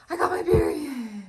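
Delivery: high, giddy burst; thrilled, high-pitched reveal; excited announcement. Real, not acted. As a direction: sing out the good news in a high voice. Announces long-awaited period news.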